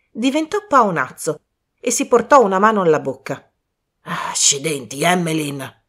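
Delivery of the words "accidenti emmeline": understated growl of annoyance, drawn out slowly; mild roar. Acted. Colonel to his wife after biting glass, mildly roaring. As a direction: curse slowly without raising the voice. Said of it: "ruggì".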